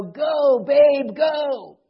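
high, loud shout through the crowd; coach's yell. Retold or imitated. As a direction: yell it like a coach urging on a runner. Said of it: "He would yell".

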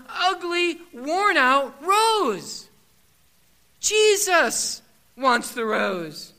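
loud, slow preaching; Somebody wants the rose, loud and slow; preaching at full force. Acted. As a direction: drive the point home slowly. Climax of the rose illustration.

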